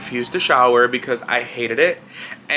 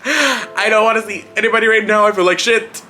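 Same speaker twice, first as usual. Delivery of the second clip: whiny complaint; 7 semitones higher than usual. Retold or imitated. Complaining about visitors.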